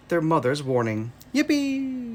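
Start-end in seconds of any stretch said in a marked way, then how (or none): 1.3-2.1 high squealy yippee; gleeful pig yippee; yippee cheer. Acted. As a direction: shout it for pure joy, way up high. Pigs dancing.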